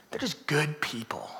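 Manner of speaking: soft, low whispered praise; fond whisper. Acted. Pastor fondly describing good people, whispered. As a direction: whisper it like a secret.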